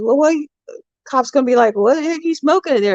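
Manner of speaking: high, quick mock cop question; husband voicing cop. Husband imagining the cop's question, high and quick. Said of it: "the guy goes".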